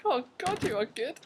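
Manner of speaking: high, breathy-sounding swoon. Retold or imitated. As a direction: gush over the handsome doctor. Swooning over fit Irish doctor.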